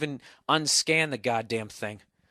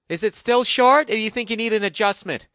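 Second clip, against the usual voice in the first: high, mock-concerned follow-up call; mock caller; 7 semitones higher, 5 dB louder than usual. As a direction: imitate a fussy salon check-in, pitched up. Mocking a follow-up haircut call.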